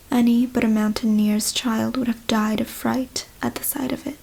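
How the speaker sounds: plain reading voice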